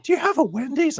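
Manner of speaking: high-pitched, curious put-on voice; eager fan impression. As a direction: ask eagerly in a squeaky-high mimicking voice. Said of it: "this is literally how he's, uh, how he's speaking".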